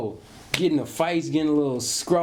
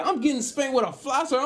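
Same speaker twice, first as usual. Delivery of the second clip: normal talking voice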